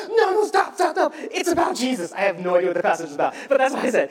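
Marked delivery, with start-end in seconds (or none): 0.1-2.1 high, loud, frantic plea; urgent calling out. Retold or imitated. Student minister calling back woman walking away, urgent. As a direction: stop someone before they leave.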